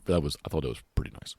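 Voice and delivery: ordinary delivery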